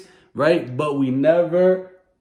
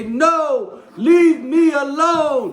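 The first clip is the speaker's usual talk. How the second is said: high, slow shouted refusal; loud yell; 11 semitones higher, 6 dB louder, much slower than usual. Shown as a demonstration. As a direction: yell it so an adult hears. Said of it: "And you yell it really loud".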